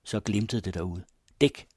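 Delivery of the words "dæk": sudden shout to take cover; short mild shout; shouted command. A narrator's reading. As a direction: bark the warning. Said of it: "blev der råbt".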